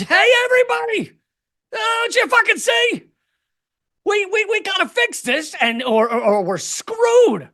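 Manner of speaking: high, strained shout to the crowd; desperate appeal. Acted. Comic begging everybody to fix this, desperate appeal. Acts out own desperate message.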